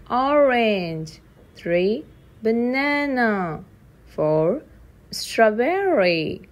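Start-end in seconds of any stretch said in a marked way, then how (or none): none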